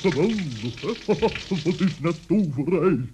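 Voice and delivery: high, amazed and laughing; a high delighted gasp of wonder. Acted. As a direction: marvel at it like magic. Delighted by running water.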